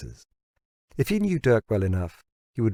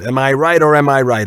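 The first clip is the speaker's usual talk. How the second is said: loud, high rhetorical boast; triumphant challenge; 7 semitones higher, 11 dB louder than usual. Acted. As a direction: crow it out, daring him to disagree.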